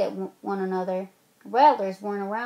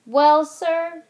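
loud, raised storyteller's opening; grand opening; 6 semitones higher, 8 dB louder than usual. A narrator's reading. Grandma opening her turn loudly for the kids. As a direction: launch the tale with a flourish.

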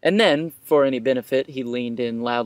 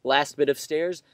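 usual speaking voice

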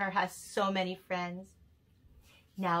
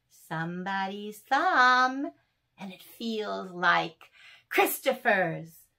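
tender poem reading; 3 semitones higher, 7 dB louder than usual. Acted. Reading Christopher's love poem to Anne, lilting. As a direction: read it like a gentle love poem.

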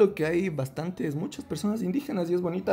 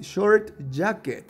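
each word drawn out slowly for the class; slow model reading; 3 semitones higher, much slower than usual. Shown as a demonstration. Teacher to pupil, modelling the phrase slowly. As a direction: say it slowly so the pupil can copy. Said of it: "Una vez más".

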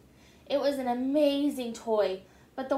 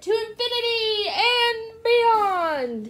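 much higher, louder, slow, stretched battle cry; heroic cry; 10 semitones higher, 6 dB louder, slower than usual. Acted. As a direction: launch it like a triumphant hero leaping. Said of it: "he cried".

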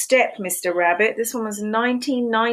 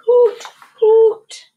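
high, loud owl hooting; loud high hoo-hoo of an owl; 14 semitones higher, 10 dB louder than usual. Acted. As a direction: hoot like an owl. Owl hooting in picture book.

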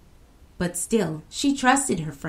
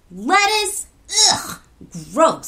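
disgusted; 10 semitones higher, 7 dB louder, slower than usual. A narrator's reading. Child disgusted by lettuce.

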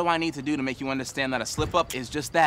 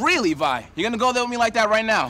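loud, indignant challenge; raised voice; 5 semitones higher, 5 dB louder than usual. Acted. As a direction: fire back in a raised, offended voice. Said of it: "Please don't shout at me".

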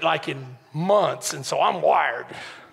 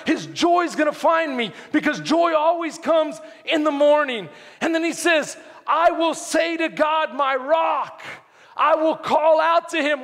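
fervent, raised preacher's voice; driving sermon delivery, pitched up; impassioned preaching; 10 semitones higher than usual. Real, not acted. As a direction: preach it with urgency and conviction. Preaches at full force.

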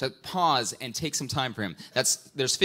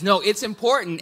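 voicing God, insistent; 5 semitones higher, 5 dB louder than usual. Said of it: "God says".

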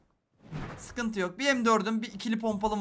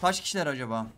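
ordinary delivery

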